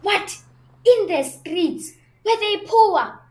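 high, loud incredulous questions; can't believe what he's hearing; gasping at the news; shocked disbelief. Acted. Shocked at a street wedding.

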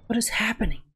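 breathless scared whisper; frightened whisper. Real, not acted. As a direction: whisper it in fear. Scared in horror game.

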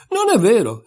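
high, loud indignant protest; indignant cry. A narrator's reading. Alice denying she is only a dream, indignant outburst. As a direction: push back hard, offended. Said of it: "esclamò indignata Alice".

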